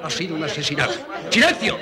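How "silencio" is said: sharp call for silence; commanding shout. Acted. Demands silence.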